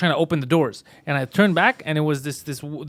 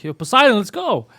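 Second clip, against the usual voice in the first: higher, louder imitated call; retold yell; 5 semitones higher, 3 dB louder than usual. Man calling his friend out of the bathroom, retold yell. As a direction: call out to a friend through the door, contained. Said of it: "I yelled, like".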